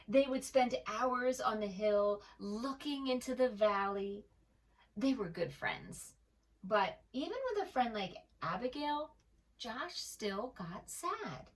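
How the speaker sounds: put-on gentle read-aloud voice for children; storybook narration. A narrator's reading. Narrator reading a picture book to young listeners, gentle.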